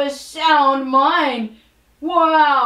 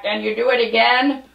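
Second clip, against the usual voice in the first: ordinary talk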